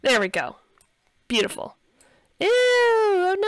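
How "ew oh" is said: high, loud drawn-out ew; disgusted dismay. Real, not acted. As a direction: recoil with a high, long "ew, oh no". Reacting to a broken fridge.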